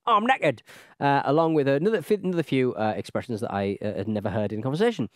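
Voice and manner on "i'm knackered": high, loud slangy complaint; British slang exclamation. Acted. Reading slang examples from a listener's letter, exaggerated colloquial complaint. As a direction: act out the slang with gusto.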